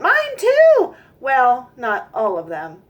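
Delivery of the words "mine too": high, loud eager shout; calling out. Acted. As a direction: shout it out like excited kids. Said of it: "called out".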